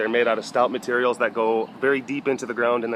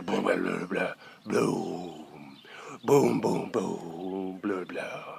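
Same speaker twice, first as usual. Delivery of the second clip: low rumbling boom and blah, softer; thunder impression; 7 semitones lower, 8 dB quieter, much slower than usual. Shown as a demonstration. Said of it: "That's my thunder impression".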